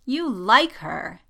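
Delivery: slow, loud, knowing tease; sly teasing. A narrator's reading. Poe realises Jess has a crush, slyly teasing. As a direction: draw it out, savouring the discovery.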